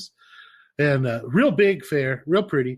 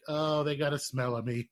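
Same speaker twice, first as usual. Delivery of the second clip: quiet chuckle; 7 dB quieter than usual. Retold or imitated. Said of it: "And I'm like".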